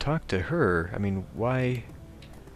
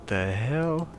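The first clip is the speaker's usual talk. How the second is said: baffled; 4 dB quieter, slower than usual. Real, not acted. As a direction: draw it out in real bewilderment. Puzzled by what the game shows.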